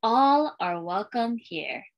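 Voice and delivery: put-on lilting read-aloud for young kids, refrain given room; storytime reading. Librarian reading a welcome book to schoolchildren, storytime lilt. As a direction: read it like a picture book to a class.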